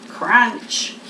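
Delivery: plain reading voice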